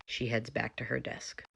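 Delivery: hushed aside to the audience; whispered narration. A narrator's reading. Narrator whispering the stage direction to the viewers.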